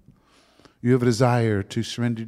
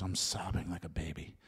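choked whisper; 5 semitones lower, 11 dB quieter than usual. Real, not acted. Preacher choked up retelling his brother's embrace, whispering. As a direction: voice dropping to a near whisper. Emotional testimony of weeping.

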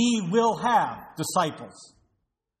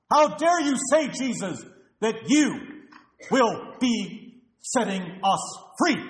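mock-indignant, louder and higher, how dare you; indignant outburst; 3 semitones higher, 4 dB louder than usual. Preacher mocks a proud listener confronting Jesus, indignant. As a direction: play the offended patriot scolding Jesus. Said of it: "and you inform him".

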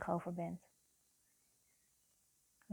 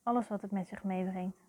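guided meditation. Real, not acted. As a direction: guide the listener into their feelings, phrase by phrase. (langzame, begeleide meditatie met lange pauzes)

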